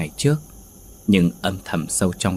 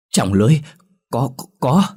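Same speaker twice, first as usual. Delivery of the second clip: shocked stutter rising higher on the repeats; stammering in fear; 5 semitones higher, 4 dB louder than usual. Acted. Shaken wife stammers to her husband what is in the net. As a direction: choke on the words in fright. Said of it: "ấp úng nói được vài câu".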